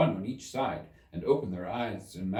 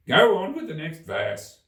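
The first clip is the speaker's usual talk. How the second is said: louder, pushy Gryphon; impatient order, a bit louder; 8 semitones higher, 9 dB louder than usual. Acted. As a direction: hurry her along, annoyed. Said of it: "repeated impatiently".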